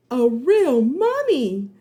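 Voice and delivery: slow, loud, awed exclaim; awed exclamation; amazed. A narrator's reading. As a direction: gasp it out in awe. Seeing the mummy.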